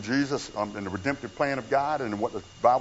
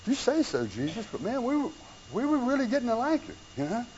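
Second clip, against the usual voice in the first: puzzled; 7 semitones higher, 5 dB quieter than usual. Retold or imitated. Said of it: "just scratching their heads going".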